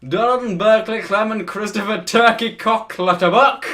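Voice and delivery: loud, high-pitched, hammed-up posh accent. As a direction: declaim the names like a posh toff. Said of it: "the most posh voice you can".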